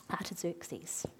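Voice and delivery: "Artaxerxes" whispered under the breath; whispered name. Real, not acted. Preacher trying out an unusual name, whispering "Artaxerxes". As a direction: whisper "Artaxerxes" as if checking it privately.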